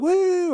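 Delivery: high-pitched sustained "woo"; fan cheer. Retold or imitated. Canadian fans watching on camera, high cheering whoop. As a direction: whoop like an excited fan in the stands. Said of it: "they're all like".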